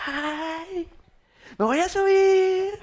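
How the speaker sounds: high-pitched, drawn-out delighted squeal; hammed-up glee as mom; very happy mother. Mother climbing the water slide, mock gleeful squeal. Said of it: "está subiendo bien contenta".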